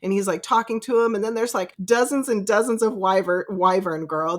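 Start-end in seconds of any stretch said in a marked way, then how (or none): none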